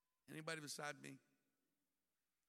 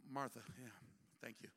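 dismissive brush-off; 5 semitones lower, 4 dB quieter than usual. Acted. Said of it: "one of those".

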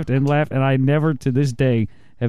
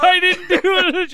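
high, slow, laughing denial; boy denying it to his angry grandpa, mock pleading; mock protest; slower than usual. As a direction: whine your innocence through giggles. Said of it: "He's like".

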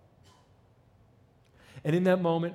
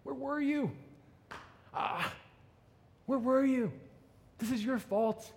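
high, pleading grief at where were you; a hint of weeping reproach; mild mock sobbing plea; Mary grieving; 5 semitones higher than usual. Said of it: "and she said".

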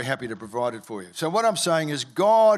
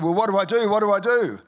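higher and louder, frantic; a frightened convert crying out what to do; panicked plea; 6 semitones higher, 5 dB louder than usual. Retold or imitated. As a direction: beg for help in a panic. Panicked man retold.

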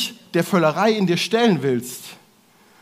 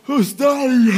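high loud Ustali; a loud, high exclaim of tiredness; loud cry; 7 semitones higher, 6 dB louder, slower than usual. Shown as a demonstration. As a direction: call out Ustali with force.

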